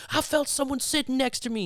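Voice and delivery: high, mock-frightened retelling; hammed-up scared whine; mock spooked friend. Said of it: "And he's like".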